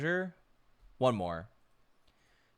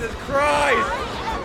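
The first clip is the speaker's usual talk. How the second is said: shocked shout; 9 semitones higher, much slower than usual. Real, not acted.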